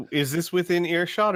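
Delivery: usual speaking voice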